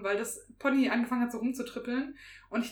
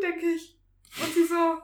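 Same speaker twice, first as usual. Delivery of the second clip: normal talking voice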